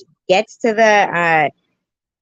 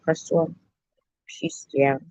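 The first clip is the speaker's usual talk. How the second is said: soft mock-French "Pustule"; put-on French pronunciation; 2 semitones lower, 7 dB quieter than usual. Shown as a demonstration. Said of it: "how they say it in French".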